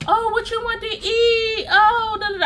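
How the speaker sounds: high, eager mock of her old self; mock eager girlfriend. Retelling her younger self to viewers, mock eager and high.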